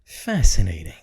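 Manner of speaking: cool detached observation; deadpan Spock. Retold or imitated. Answering a rude person with Spock-like calm, low. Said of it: "look at them and say".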